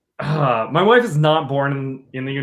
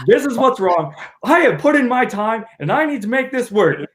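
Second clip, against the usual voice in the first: firm demand; 8 semitones higher than usual. Retold or imitated. Wife quoted confronting attorney.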